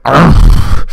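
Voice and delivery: put-on a bitter old man brushing it off; grumpy old man voice. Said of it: "he's kinda grumpy anyways".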